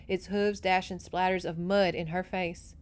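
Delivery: plain reading voice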